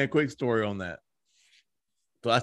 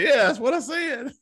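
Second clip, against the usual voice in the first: bursting into laughter; laughing through the words; 13 semitones higher than usual. Real, not acted. Laughing at the awkward moment.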